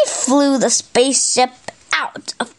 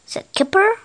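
ordinary delivery, nothing marked

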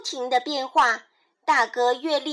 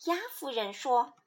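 usual speaking voice

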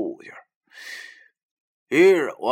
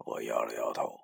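ordinary delivery, nothing marked

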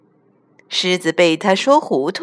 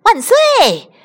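louder, high joyful cheer; 14 semitones higher, 6 dB louder than usual. A narrator's reading. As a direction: cheer with delight. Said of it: "猴子大笑着说".

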